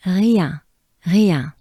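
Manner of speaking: "rien" with French R and nasal ending; French R demo. Shown as a demonstration. Teacher breaking down the name's ending, French R. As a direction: repeat "rien", starting on a breathed R. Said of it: "a French R".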